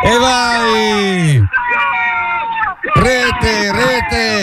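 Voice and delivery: jubilant cry of goal; joyful cheer. Real, not acted. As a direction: celebrate the goal. Goal scored, co-commentator cheering.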